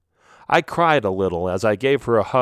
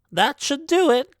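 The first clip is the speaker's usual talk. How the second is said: witch voice. Acted. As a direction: draw it out like a knowing witch.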